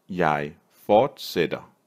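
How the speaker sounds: slow, with a gap between "Jeg" and "fortsætter"; slow teaching take. Shown as a demonstration. Teacher modelling a Danish verb for learners, slowly word by word.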